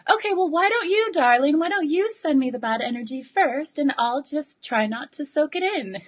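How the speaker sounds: a hint of the sunny partner cooing an offer; imitating partner. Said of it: "And she said".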